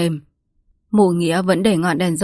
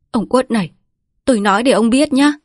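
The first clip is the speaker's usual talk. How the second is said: cảnh cáo; 5 semitones higher, 5 dB louder than usual. A narrator's reading. Woman threatening with knife.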